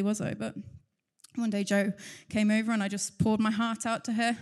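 ordinary talk, nothing marked

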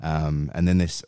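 ordinary talk, nothing marked

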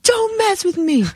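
high, fierce warning; re-enacted tough threat, high-pitched; retold angry warning. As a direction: warn them off with pitch way up. Retold street quarrel, her threat.

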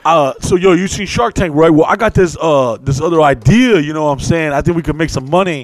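hammed-up shady investment bro; pitching an idea at a party; pitching hustler.

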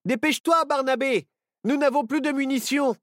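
high, loud hurry-up call; urgent call. Acted. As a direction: yell at him to hurry. Urging during fight.

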